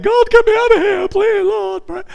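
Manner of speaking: mock-frantic cry for rescue; desperate plea. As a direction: beg God to get you out, hammed-up. Desperate prayer inside the fish.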